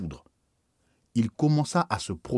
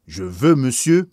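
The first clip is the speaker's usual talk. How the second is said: slow, louder commanding voice; stern demand; 3 semitones higher, 9 dB louder, slower than usual. Acted. Bishop demanding an explanation from the priest, slow and loud. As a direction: lay down each word like an order.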